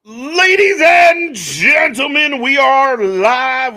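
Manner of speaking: slow, drawn-out arena announcer call; announcer shout. Acted. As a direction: boom it out like a ring announcer opening the show. Stream opening hype call.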